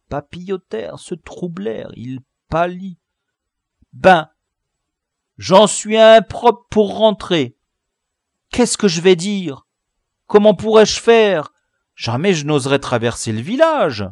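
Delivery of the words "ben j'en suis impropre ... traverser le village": loud, high-pitched panic; distraught boyish complaint; dismayed wailing. A narrator's reading. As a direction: lament it loudly, voice pushed high. Chief despairs, cannot go home.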